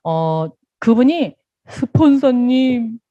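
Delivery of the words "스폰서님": a slow, stretched, pitiful call of sponsor; drawn-out plea. Retold or imitated. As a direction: drag the word out like a dejected member. A downcast member arrives.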